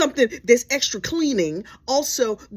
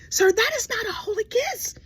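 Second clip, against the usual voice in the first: high, disbelieving correction; incredulous scold; 12 semitones higher than usual. Real, not acted. Comedian scolding James about the kiss, high incredulous. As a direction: squeak it out in disbelief.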